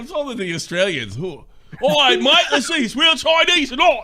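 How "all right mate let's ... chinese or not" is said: high, loud Australian bloke voice; Aussie mate voice, hammed right up; Australian accent. Mocking the reaction as an Aussie, Australian accent. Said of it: "That was a really good accent".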